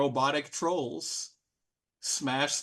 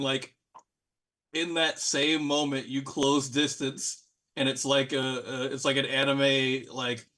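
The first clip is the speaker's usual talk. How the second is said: normal talking voice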